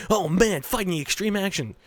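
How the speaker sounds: high, hyped-up action-movie cry; mock excitement. Let's Player mocking viewers' expectations of action, mock excitement. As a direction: play up fake thrills, loud at first. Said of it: "it's gonna be".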